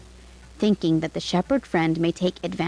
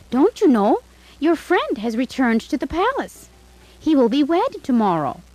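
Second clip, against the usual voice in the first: excited news; 6 semitones higher than usual. A narrator's reading. Neighbour breaks the news.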